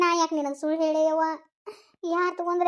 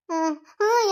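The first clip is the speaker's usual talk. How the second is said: ordinary talk, nothing marked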